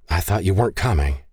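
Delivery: usual speaking voice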